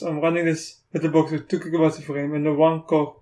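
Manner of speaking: ordinary talk, nothing marked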